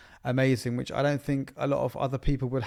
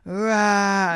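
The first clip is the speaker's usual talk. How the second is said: short mock child's yell, higher and louder; imitated toddler scream; 8 semitones higher, 5 dB louder than usual. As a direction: bark out a toddler's tantrum scream. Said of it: "she was screaming at the top of her lungs".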